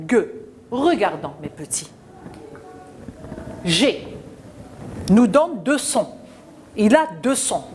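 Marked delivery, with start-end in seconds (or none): none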